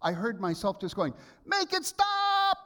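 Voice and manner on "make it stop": much higher, louder, slow desperate wail; stretched-out plea, voice high and loud; desperate inner cry. Retold or imitated. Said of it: "the voice I heard in my head".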